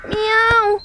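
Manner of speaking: high alarmed meow; a kitten's startled cry. Acted. Kitten meows at fighting roosters.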